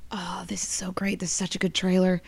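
roommate whispering in the theater; hushed, breathy aside during the trailer; retold whisper. Roommate whispering excitedly in the dark cinema. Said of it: "and she's like".

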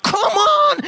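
sudden high yelp; exasperated yelp. Retold or imitated. Dad yanking the toilet door, yelping high. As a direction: squeal it in frustration.

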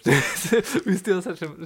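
slow words through laughter; laughing. Real, not acted. Telling the listener she hates Star Wars, laughing. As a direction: let the laugh drag the words.

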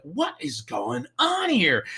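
high, drawn-out incredulous question; exasperated bafflement. Retold or imitated. Retelling strange pains in the restroom, exasperated bafflement. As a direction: stretch it out in mock bewilderment.

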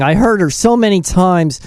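ordinary talk, nothing marked

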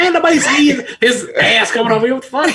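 high, mock-outraged boss; Vince McMahon. Wrestling boss reacting to the rapper's announcement, mock outrage.

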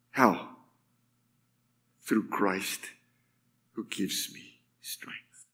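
low, slowing down into near whisper; hushed conviction. Acted. Preacher recalling what Paul says, hushed. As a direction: let the verse fade away with long pauses. Answers with quoted verse, hushed.

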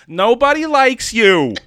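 high, slow melodramatic shout; mock yelling. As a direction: wail the insult with hammed-up outrage. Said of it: "She's yelling at a man".

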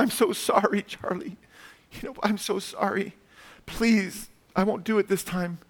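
high, soft mock-crying apology; tearful pleading. Lucy begging Charlie to forgive her, acted tearful and pleading. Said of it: "Then she starts crying".

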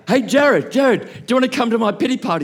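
inviting Jared, loud and keen; calling out. Retold or imitated. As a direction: call to a mate across the room.

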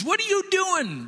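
very high-pitched mock outrage; appalled outburst. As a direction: cut in with an exasperated squawk. Balak interrupts the blessing, appalled.

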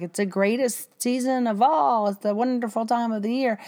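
bright, high, sing-song cheer; overly chirpy holiday voice; mock cheerful. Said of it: "everybody's like".

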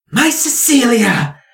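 high, loud cry of relief; relieved mother. Acted. Mother greeting her returning daughter, high relieved cry. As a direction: burst out with a mother's joy. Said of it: "she goes".

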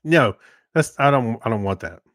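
usual speaking voice

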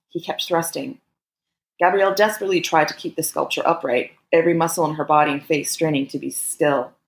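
ordinary delivery

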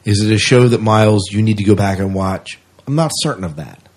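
ordinary talk, nothing marked

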